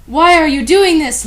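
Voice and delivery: high, loud demand; hammed-up anger; angry accusation; mock angry. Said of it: "Lupin said angrily".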